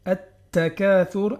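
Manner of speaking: At-Takathur with a doubled t; slow At-Takathur. Shown as a demonstration. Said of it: "il faut qu'on double la lettre d'après".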